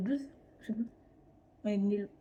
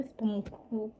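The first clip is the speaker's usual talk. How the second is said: real stumble on the word, quiet; stammering; 7 dB quieter, slower than usual. Real, not acted. YouTuber rusty on camera explains the drawings, stammering. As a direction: trip softly over "cou-, cousin". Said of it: "désolé si je bégaye".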